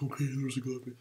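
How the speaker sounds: high-pitched hurried concession; retold flustered agreement. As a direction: give in, still wound up. Grudgingly agrees while still panicking.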